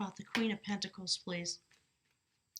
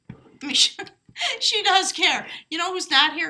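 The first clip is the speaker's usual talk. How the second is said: higher, much louder, through laughter; laughing; 7 semitones higher, 8 dB louder than usual. Real, not acted. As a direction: burst out loudly while laughing. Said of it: "I'm sorry I'm laughing".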